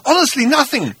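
high, earnest anxious denial; insistent Arthur. Acted. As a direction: plead innocence. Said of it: "insisted Arthur".

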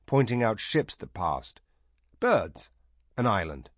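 plain reading voice

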